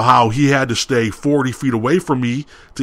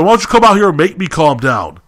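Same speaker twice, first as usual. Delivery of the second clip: louder, higher retold challenge; angry challenge; 5 semitones higher, 5 dB louder than usual. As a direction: act out squaring up to the cook.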